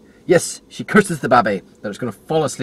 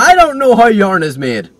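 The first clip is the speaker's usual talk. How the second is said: exasperated outburst; 11 semitones higher, 12 dB louder, slower than usual. Real, not acted. Exasperated after being corrected.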